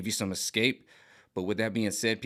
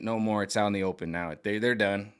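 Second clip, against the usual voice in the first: ordinary talk, nothing marked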